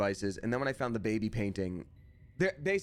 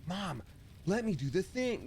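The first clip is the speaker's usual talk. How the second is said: put-on higher, teenage protest to a parent; whiny teenage self; 8 semitones higher, slower than usual. Grown kid reverting to a teen with mom, whiny protest. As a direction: revert to a pleading teen, pitch up. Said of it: "revert to your teenage self".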